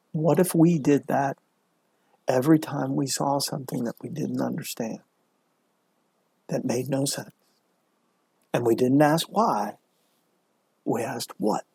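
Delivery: storyteller closing the moral, hushed. Real, not acted. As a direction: let the moral sink in quietly.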